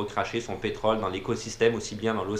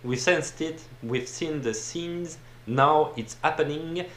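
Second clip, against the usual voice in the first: slow, higher English read with a heavy French accent; clumsy French accent; 5 semitones higher, slower than usual. Shown as a demonstration. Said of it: "mon accent en anglais de poubelle". (anglais lent et appuyé, avec un gros accent français)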